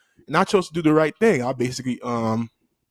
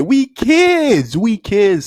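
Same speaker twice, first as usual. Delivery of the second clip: louder, higher plea; emphatic insistence; 8 semitones higher, 4 dB louder, much slower than usual. Real, not acted. Insisting they were kids.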